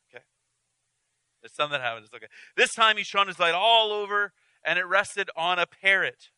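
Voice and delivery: ordinary talk, nothing marked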